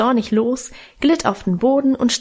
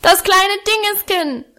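put-on toddler bellowing; 10 semitones higher than usual. A narrator's reading. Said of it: "brüllte er".

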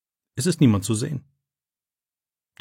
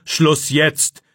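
louder, curt "Schluss jetzt"; commanding; 4 semitones higher, 4 dB louder than usual. A narrator's reading. Teacher cuts the boy off, louder and commanding. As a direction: cut him off with an order. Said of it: "befiehlt Herr Wagner".

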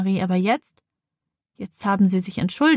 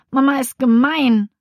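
higher, louder, sulky grumbling; childish scolding; 5 semitones higher, 4 dB louder than usual. Acted. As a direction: stomp off complaining about mum. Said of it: "schimpft sie".

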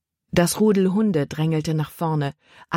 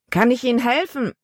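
put-on higher-pitched boy calling from the rail; 7 semitones higher than usual. A narrator's reading. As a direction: call down a polite offer of help. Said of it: "rief er herunter". (von oben heruntergerufen)

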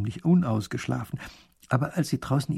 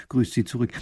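ordinary delivery, nothing marked

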